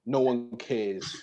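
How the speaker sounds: dry dismissal. Real, not acted. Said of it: "dismissive drawn-out remark".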